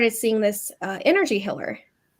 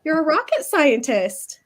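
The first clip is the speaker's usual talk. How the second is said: sarcastic; 7 semitones higher than usual. Retold or imitated. Sarcastic retort to therapist.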